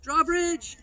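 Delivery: high, loud joking call-out; dad's yell. Retold or imitated. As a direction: yell it like Dad spotting the sign. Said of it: "Dad will yell".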